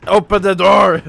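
very loud, high yell; sudden shout. Real, not acted. Player demands the door be opened, high full-voiced shout. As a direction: bellow it at full force.

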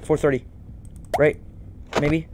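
ordinary talk, nothing marked

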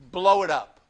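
usual speaking voice

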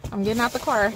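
"car" said in a real Chicago accent. Real, not acted. Woman telling followers she is home, Chicago accent. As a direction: say it real Chicago, "car" drawn toward "core". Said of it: "I just said the real Chicago".

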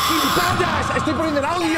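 furious high-pitched shouting; high, exasperated shout; angry yell. Real, not acted. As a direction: yell an insult back in outrage. Insults the game after a scare.

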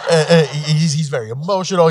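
chuckling through the words; amused, laughing fondly. Real, not acted. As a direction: laugh as you describe him. Laughing about the worst gang member.

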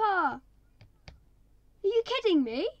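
can't believe what just happened; exasperated. Real, not acted. Player reacting to losing parrot, loud exasperated disbelief.

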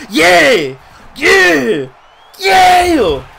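loud, high victory shouts; triumphant yell. Real, not acted. Gamer celebrating a big hit on the field with shouts. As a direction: roar it out in celebration.

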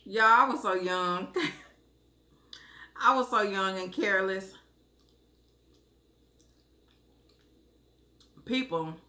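speaking through a smile; amused giggly delivery; laughing while talking. Real, not acted. Seen 1: Smiling and laughing, spoon held; no chewing seen during words.

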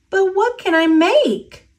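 put-on high, wondering little girl; high child's voice, wondering; young girl's voice. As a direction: ask it like a puzzled child. Rosita's quoted question, child.

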